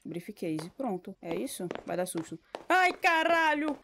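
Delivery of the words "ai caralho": loud, drawn-out cry of fright; startled cry. Real, not acted. Streamer spooked by a noise in the game, loud fearful cry. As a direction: get caught off guard and cry out slowly. Another jump scare.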